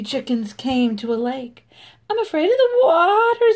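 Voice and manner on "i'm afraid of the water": higher chicken voice; pitched-up worried chicken; scared chicken. Acted. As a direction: say it like a nervous hen. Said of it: "said one of the chickens".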